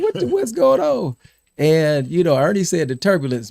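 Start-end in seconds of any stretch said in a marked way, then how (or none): 0.0-1.2 high-pitched retold panic; mock alarm. Passengers reacting to the rough flight, mock alarm. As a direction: voice jumps high in startled disbelief.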